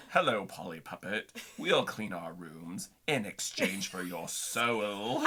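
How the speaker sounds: low, slow devilish puppet. Retold or imitated. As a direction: growl it like a little devil. Wednesday's devil puppet retold.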